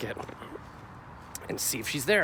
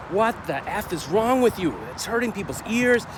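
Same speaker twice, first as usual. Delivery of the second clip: higher, sharp retold telling-off; snapping angrily; 8 semitones higher than usual. Yelling at the man honking a horn beside him, angry snap. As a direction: let him have it, reliving the moment. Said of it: "that's when I snapped".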